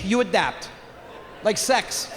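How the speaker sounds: normal talking voice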